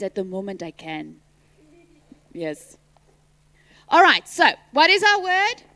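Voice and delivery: ordinary talk, nothing marked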